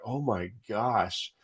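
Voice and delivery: hushed, drawn-out mock amazement; retold stunned disbelief. Player recalls being dropped on his face, stunned. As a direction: say it slow and soft, as if stunned.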